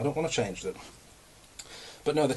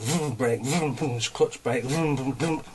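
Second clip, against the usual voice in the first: playful mouth-made engine revs; engine vroom noises; much slower than usual. Retold or imitated. Describing the gear shuffle commute, imitated engine revs. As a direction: rev it like a car stuck in traffic.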